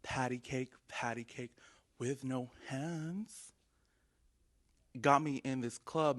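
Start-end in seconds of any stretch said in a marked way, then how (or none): none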